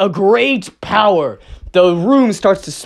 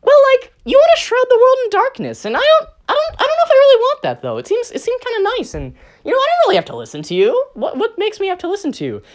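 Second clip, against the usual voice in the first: much higher, fast, defiant creature voice; squeaky minion defiance; faster than usual. Acted. WSB defying his creator, in a much higher squeaky voice. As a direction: rattle it off in a high squeaky rush.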